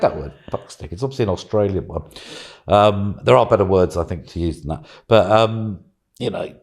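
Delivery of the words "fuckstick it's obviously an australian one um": usual speaking voice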